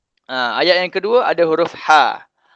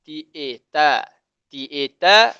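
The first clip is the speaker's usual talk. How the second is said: ordinary talk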